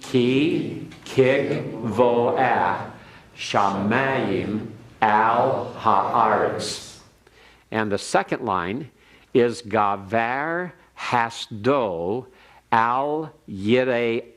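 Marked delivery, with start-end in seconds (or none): 0.0-7.0 very slow, drawn-out Hebrew in unison; slow choral repeat. Shown as a demonstration. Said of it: "everyone repeat it". 9.6-14.3 slow, careful Hebrew reading; slow reading aloud. Shown as a demonstration. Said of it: "let me read it one more time".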